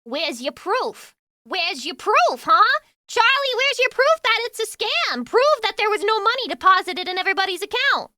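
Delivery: hammed-up outrage, demanding proof; mock indignant defender. Cohost mocking the moderator demanding proof, in a ranting mock yell.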